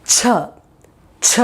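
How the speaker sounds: "ch" pushed out with a puff of air; aspirated ch. Shown as a demonstration. Teacher demonstrates the aspirated sound, "ch" with a strong puff. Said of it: "You give more pressure".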